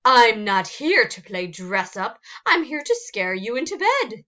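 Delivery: loud, rising irritated monster; angry snarl. Acted. Cynthia refusing to play dress up, loud and cross. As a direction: scold her like an offended monster. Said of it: "Cynthia snarled".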